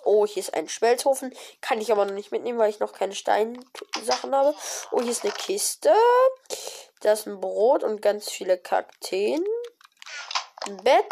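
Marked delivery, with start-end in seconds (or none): none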